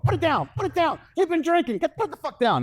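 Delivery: high, shrill-voiced mock yell from the wife; scolding wife. Wife scolding drunk husband with pistol, high mock yell. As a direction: squawk at the husband to drop it. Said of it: "she just went on bitching mode".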